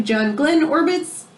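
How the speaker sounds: ordinary delivery, nothing marked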